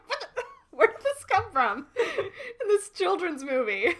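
high, loud, laughing through the words; helpless giggly outburst; incredulous laughter. Real, not acted. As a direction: crack up while asking in disbelief. Laughing over a surprising movie line.

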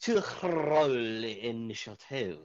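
slow drawn-out announcement; announcing. Acted. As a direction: call it out slowly like a game master. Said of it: "GM calls for initiative".

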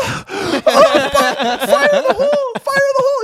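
very high, loud mock screaming; yelling in panic. Imitating sister hit by hot sauce, panicked yell. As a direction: squeal it like a sister with a burning mouth. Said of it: "she starts yelling".

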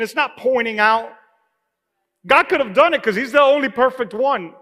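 loud, high preacher's cry; fervent preaching. Acted. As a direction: preach it out to the church. Preaching at full force.

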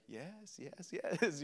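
very soft, unmoved yeses; hushed insistence. Acted. God answering a pleading believer, hushed insistence. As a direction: answer the wailing in a near murmur.

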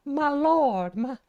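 high, slow, fainting belle voice; Southern belle with vapors. Pretend. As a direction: clutch your pearls, swooning. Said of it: "I'm getting the vapors".

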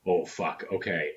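normal talking voice

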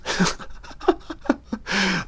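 breathy laugh. Real, not acted. Laughs at own bit.

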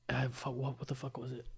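real hushed searching for a memory; muttering to self. Real, not acted. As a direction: mumble while trying to remember. Trying to recall, to himself.

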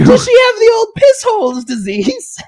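high, loud huckster pitch; snake oil salesman. Acted. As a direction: sell the cure like a shouting quack. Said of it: "we're like snake oil salesmen right now".

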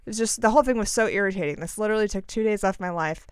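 ordinary talk, nothing marked

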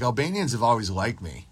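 normal talking voice

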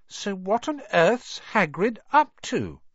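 put-on slow, high wondering girl; puzzled Hermione. A narrator's reading. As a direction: wonder aloud slowly.

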